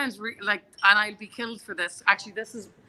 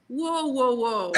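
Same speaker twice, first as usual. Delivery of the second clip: retold protest; 4 semitones higher, much slower than usual. Retold halting of a rude customer.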